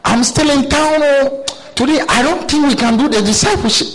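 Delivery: the reluctant disciple brushing off his mentor on the phone; disciple making excuses. Acted. Disciple on the phone, dodging discipleship, voice pitched high.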